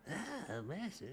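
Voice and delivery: high-pitched creepy servant voice; squeaky, groveling henchman; creepy doctor voice. Retold or imitated. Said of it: "creepy doctor voices".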